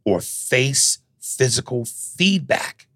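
slow, deliberate reread, each word weighed; slow savoring reread. Shown as a demonstration. Stunned by the letter, he rereads the line slowly. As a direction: read it back slowly, savoring the outrage.

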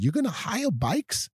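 a hint of an Australian girl's voice, pitched high; light Australian accent. Mimicking the Australian girl popping up to ask about bikes, Australian accent. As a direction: lightly imitate the Australian accent, asking brightly. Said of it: "I heard this Australian accent".

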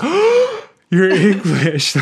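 exaggerated fake astonishment; mock shocked gasp. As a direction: ham up the shocked realisation. Mock gasp at English accent.

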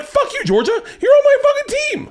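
very high-pitched mock outrage; exasperated comic rant, pitch way up; retold indignant outburst. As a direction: protest betrayal in a squeaky-high pitch. Retold angry outburst at partner.

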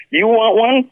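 high squeak of disbelief; you can't believe it; astonished. Retold or imitated. To Tomo, amazed he wants a pedal, high astonished. Shocked at the German buyer.

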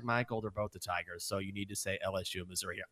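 soft, fast self-correction under his breath; muttering to himself. Retold or imitated. Writing the script, he quickly mutters a reminder to himself, soft and fast. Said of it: "I was like".

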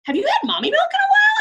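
put-on talking to a little kid, playfully; hammed-up high sweet question; sing-song to child. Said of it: "And I'm like".

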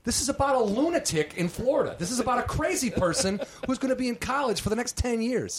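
high, rising disbelief all through; incredulous rant. Retold or imitated. Reading about himself, high incredulous rant.

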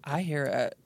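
ordinary talk

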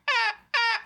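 very high bird-cry imitation; imitating a seagull's call. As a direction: squawk like a distant bird. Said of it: "it'd be like".

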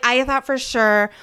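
ordinary delivery

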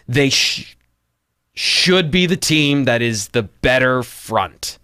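halting start, then slow, drawn-out words; reluctant, hedged verdict with a stumble; very hesitant. Real, not acted. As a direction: stall on "should", then ease the claim out slowly. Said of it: "I say that with as much hesitation".

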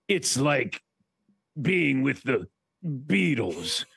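imitated sportscaster, slow and drawn out; Chris Berman. Sportscaster boasting to new co-host, imitated slow and grand. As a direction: stretch it out with pauses, mock-grand. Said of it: "then he said to me".

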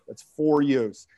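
ordinary delivery

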